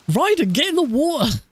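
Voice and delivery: high, sudden call across the room. Real, not acted. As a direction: call him into the water, high. Calling out to a player.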